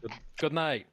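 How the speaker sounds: high, ringing show-closer farewell; bow out like a comedian leaving the stage; mock sign-off. GM taking a mock bow to the players, high and hammy.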